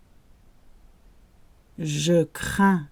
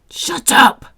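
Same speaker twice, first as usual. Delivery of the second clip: loud, higher shouted order; angry shout; 6 semitones higher, 7 dB louder than usual. Shown as a demonstration. Teacher shouting shut up as an example. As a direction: yell it at someone who won't stop. Acting out rude command.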